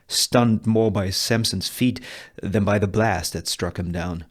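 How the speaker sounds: normal talking voice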